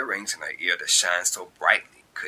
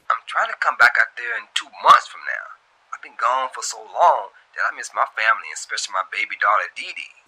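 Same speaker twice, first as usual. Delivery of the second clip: a hint of [name], louder and quick; lightly voiced young man, louder and fast; 4 semitones higher, 7 dB louder, faster than usual. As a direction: tell her your plans, a bit rushed.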